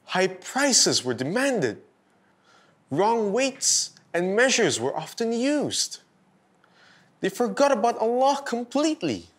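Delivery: ordinary delivery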